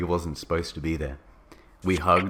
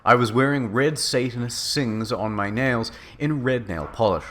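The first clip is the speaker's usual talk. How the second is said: ordinary delivery, nothing marked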